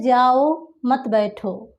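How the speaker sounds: जाओ drawn out, then a break before मत बैठो; slow जाओ, comma, मत बैठो; pause after जाओ. Shown as a demonstration. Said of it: "comma लगेगा जाओ के बाद".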